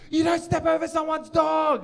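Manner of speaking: high-pitched mock shouting; hammed-up indignant yell; imitating angry stranger. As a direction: scold like an outraged dog owner. Acting out angry dog owner arguing.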